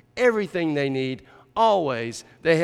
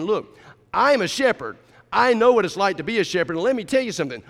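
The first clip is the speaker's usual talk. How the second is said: louder, higher, insistent declaration; emphatic David; 4 semitones higher, 5 dB louder, faster than usual. Acted. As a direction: drive each point home with force. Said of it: "how David would be saying this".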